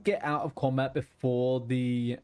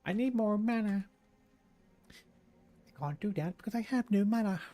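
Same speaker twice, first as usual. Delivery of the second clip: much higher, playful little gnome complaining about mana; 8 semitones higher than usual. Acted. Streamer trying out his gnome character's voice, much higher and fretful. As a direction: pitch way up like a tiny fretful gnome caster. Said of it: "What would my gnome voice be?".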